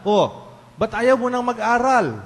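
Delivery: put-on high, loud demand of a child; acted parental reproach, loud and high; exasperated parent. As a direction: confront your child, pitched well up. Acted parent confronting a grown child.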